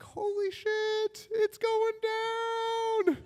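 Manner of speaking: high, slow, awestruck squeak; high awed squeak. Retold or imitated. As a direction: drag it out in stunned delight. Teen thrilled at the news.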